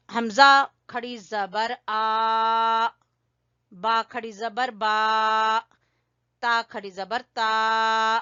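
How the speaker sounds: loud, slow drill reading for the class to repeat; recitation drill. Shown as a demonstration. Teacher leads children in reading letters aloud, slow and loud. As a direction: call out each letter slowly so pupils can follow. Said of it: "तो चलिए बेटा, इसको पढ़ना start करते हैं".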